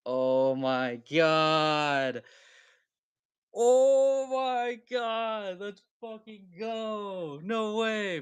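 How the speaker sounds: slow, drawn-out disbelief; stunned awe. Real, not acted. Streamer marvelling at the card. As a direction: let the awe stretch out. Reacting again to the pull.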